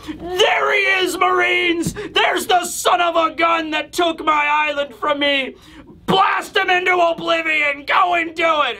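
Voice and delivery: high, strained villain yell; Bakori yelling. Retold or imitated. Deposed king ordering Marines to attack, yelling. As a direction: bellow orders like a ranting cartoon tyrant. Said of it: "We just see Bakori yelling and shouting".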